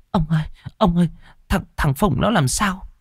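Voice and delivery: put-on a frightened girl tugging at her father; scared girl. Daughter asking what's wrong with her brother, scared.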